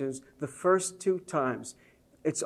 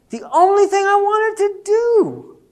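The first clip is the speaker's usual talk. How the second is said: much higher and louder, ringing out; preaching emphasis; 9 dB louder than usual. Real, not acted. Preacher proclaims the father's only wish, high and loud. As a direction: proclaim it with full force.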